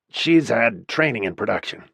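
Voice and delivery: usual speaking voice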